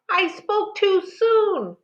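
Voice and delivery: high, slow anguished cry. A narrator's reading. Mother realizes her daughter left, high slow wail. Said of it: "Ma cried".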